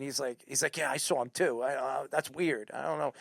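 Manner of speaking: low, muted, puzzled reply; hushed reply. Retold or imitated. Friend answering at the store about the starer, hushed. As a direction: mumble it back under the breath.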